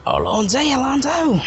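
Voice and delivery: high, ringing catchphrase impression; Tenth Doctor. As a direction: play the line up high with relish. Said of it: "I've always wanted to say this".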